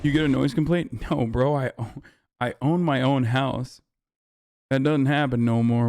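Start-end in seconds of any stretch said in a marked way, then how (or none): none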